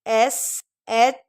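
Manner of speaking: slow letter by letter spelling; spelled slowly. Shown as a demonstration. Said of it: "rules के according". (धीरे अक्षर-अक्षर)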